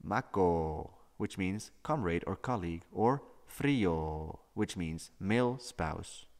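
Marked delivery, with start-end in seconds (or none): 0.0-0.9 "mako" with the final O held very long; "mako" ending on a long, drawn-out O; overlong O. Shown as a demonstration. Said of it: "An overlong example would be". 3.5-4.4 "frio" with the final O held very long; "frio" ending on a long, drawn-out O; overlong O. Shown as a demonstration. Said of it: "An overlong example would be".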